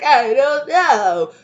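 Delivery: put-on high, very slow whining child; whiny kid. Child answering the parent, high slow whine. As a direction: drag it out like a sulky kid. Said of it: "And they're like".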